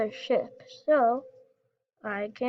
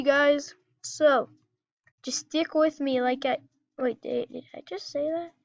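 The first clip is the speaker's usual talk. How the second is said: usual speaking voice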